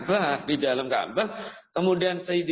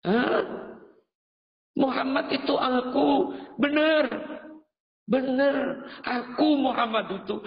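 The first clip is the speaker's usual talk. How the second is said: shocked disbelief; 9 semitones higher than usual. Acted. Pilgrim stunned to meet the Prophet, higher startled cry. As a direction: gasp it out in disbelief.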